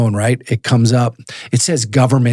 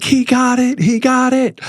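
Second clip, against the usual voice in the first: much higher, jubilant cry of good news; ecstatic yelling; 14 semitones higher than usual. Retold or imitated. Telling wife and son the friend is freed, ecstatic high yell. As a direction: shout the news to the family like it's the best day of your life. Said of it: "I'm yelling, I'm screaming".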